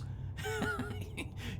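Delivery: soft high-pitched giggle; wheezy giggle. Real, not acted. Player amused by misreading human arms, soft giggle. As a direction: giggle quietly at a slip.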